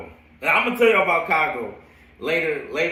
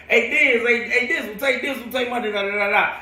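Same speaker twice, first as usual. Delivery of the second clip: higher-pitched retold cheering on; teammates calling out; 9 semitones higher than usual. Old teammates calling his college nickname, excited calling. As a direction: imitate teammates hyping him up.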